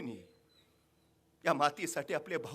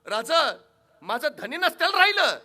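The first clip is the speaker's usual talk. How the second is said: building loud, much higher vow to fight; defiant wife; 10 semitones higher, 15 dB louder than usual. Acted. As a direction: rise to a fierce battle vow. (जिद्दीने मोठ्याने घेतलेली शपथ)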